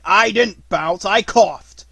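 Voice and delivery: loud, high protest; indignant, punchy denial; crossly. A narrator's reading. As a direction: object hotly, raising the voice. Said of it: "said Tigger crossly".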